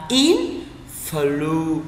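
influence split slowly into in, flu, ence; syllable by syllable. Shown as a demonstration. Teacher sums up the spelling for learners, slowly split. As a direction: separate each part of influence, holding the final s. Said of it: "Vậy thì từ đọc là".

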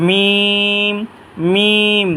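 মিম with closed lips and a long, level vowel; from dry lips. Shown as a demonstration. Teacher shows where the letter forms, drawn long. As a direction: press the lips for each M of মিম, stretching it. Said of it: "দুই টুটে শুকনো অংশ থেকে".

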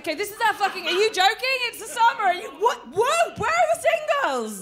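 high, incredulous mock outrage; hammed-up shocked disbelief; mock outraged single. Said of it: "Don't be like".

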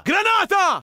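high, loud yell of alarm; shouted warning. Acted. Soldier yells a grenade warning to his squad, alarmed. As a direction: bellow it to warn everyone.

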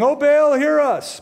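high, loud mock plea to Baal; crying out to Baal. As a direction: call out like a desperate worshipper.

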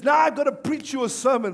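mock insistence. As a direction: act out a fed-up preacher husband. Husband announces sermon to his wife.

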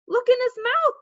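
high, loud urging; urgent. A narrator's reading. Jimmy points out the missing teeth, urgent. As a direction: insist he look right away. Jimmy urges brother to look.

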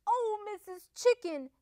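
high, sweet storybook wolf; sweetly. A narrator's reading. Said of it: "said Big Bad Wolf sweetly".

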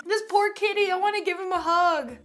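high-pitched cooing over a kitten; pitying coo. Real, not acted. Reacting to a sad kitty meme, cooing pity.

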